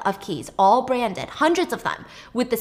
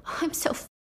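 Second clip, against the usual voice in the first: unvoiced, softer despair; 6 semitones higher, 7 dB quieter than usual. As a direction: breathe it out hopelessly. Panicked brother in car.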